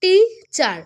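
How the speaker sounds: Teacher split as টি, pause, চার; two-part syllable break. Shown as a demonstration. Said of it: "একবার করে থেমেছি".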